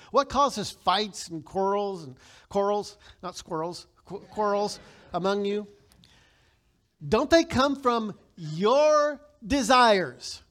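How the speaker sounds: slow, emphatic reading of the verse; scripture reading. Acted. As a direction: read it out to the congregation. Reads scripture aloud.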